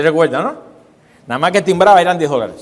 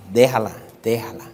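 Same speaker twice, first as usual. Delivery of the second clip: lower, hushed let-it-be; low calm voice; 8 semitones lower, 4 dB quieter than usual. Acted. Preacher voicing advice to ignore the flesh, low and calm. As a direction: soothe it aside, low and easy.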